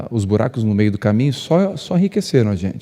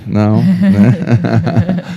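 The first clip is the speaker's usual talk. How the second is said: laughing; 5 dB louder, much slower than usual. Real, not acted. Laughing after joking question.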